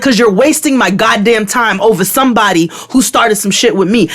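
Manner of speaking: high-pitched indignant complaint; heated mock tirade; retold angry rant. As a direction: re-enact scolding someone for wasting time. Acted angry rant at agents.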